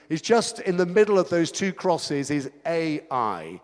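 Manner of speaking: ordinary talk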